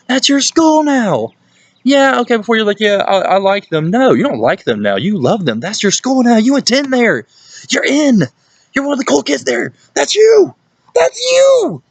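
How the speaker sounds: wildly excited high-pitched hollering; excited yelling. Real, not acted. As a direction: fire them up, voice shooting way up high. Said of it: "I'm scaring my dog right now".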